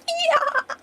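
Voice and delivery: very high mad cackle; villain laughing. Acted. Villain laughing as he kills the wife, mad cackle. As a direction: burst into a shrieking cackle. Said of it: "And he's laughing".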